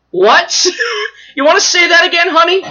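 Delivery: high, loud, sassy "honey"; a mock-sweet threat. Storyteller voices Poison Ivy confronting a flower picker, sassy threat.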